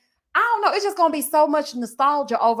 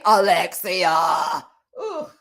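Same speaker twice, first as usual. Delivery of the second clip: disgusted groan; 4 semitones lower, much slower than usual. Retold or imitated. Voicing a disgusted cast member.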